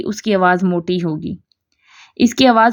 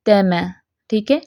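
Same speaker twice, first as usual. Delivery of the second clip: ordinary talk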